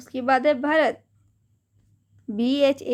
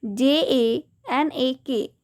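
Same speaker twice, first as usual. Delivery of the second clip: slow spelling; slower than usual. Shown as a demonstration. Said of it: "तो जनक हम कैसे लिखेंगे?".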